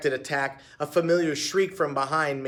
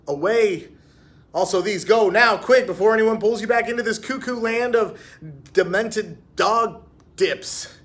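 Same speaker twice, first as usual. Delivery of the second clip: high, loud, pressing inner voice; urgent inner voice; 7 semitones higher, 6 dB louder than usual. A narrator's reading. Her own head urging her to flee, urgent and loud.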